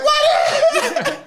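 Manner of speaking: high laughing shout of disbelief; shocked yelp. Retold or imitated. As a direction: yelp it, half laughing, stunned. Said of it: "I'm like".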